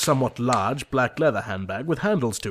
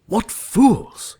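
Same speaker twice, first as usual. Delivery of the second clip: much higher, louder baffled outburst; exasperated surprise; 12 semitones higher, 9 dB louder than usual. Acted. Jack to Algy after the fools joke, loud baffled outburst. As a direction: blurt it out in exasperation.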